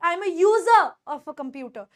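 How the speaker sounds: user ending in "zuh", no r; silent R. Shown as a demonstration. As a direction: say user as "yoo-zuh", slowly. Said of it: "आर को silent कर सकते हो". (user में आर silent, "यूज़ा")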